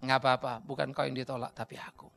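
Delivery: low, subdued reply; God answering. Acted. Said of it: "ngelapor sama Tuhan".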